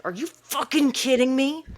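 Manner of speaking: loud, high exasperated yell; furious outburst. Retold or imitated. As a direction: blow up at a brother. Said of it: "with, like, that tone, like, that gusto".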